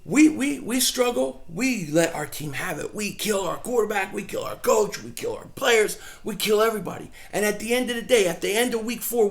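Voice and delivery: ordinary delivery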